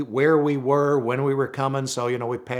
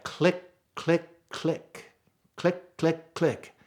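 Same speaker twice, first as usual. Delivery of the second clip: mock blinker clicks; 5 semitones higher, much slower than usual.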